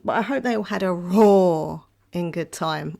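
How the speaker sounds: ordinary talk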